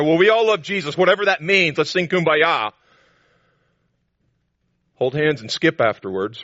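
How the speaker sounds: high, loud sing-song mockery of easy religion; mocking shallow unity. Preacher mocking shallow church unity, high, loud and sarcastic. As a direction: send up the feel-good crowd. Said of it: "not just sitting around with the lowest common denominator".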